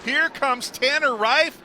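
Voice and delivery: high, loud, building final-lap call; rising finish-line play-by-play; excited race call. Acted. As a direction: ride the finish like the crowd is on its feet.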